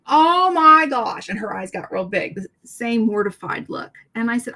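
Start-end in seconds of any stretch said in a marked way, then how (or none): 0.1-1.3 dismayed exclamation. Real, not acted. Recalling the embarrassing moment.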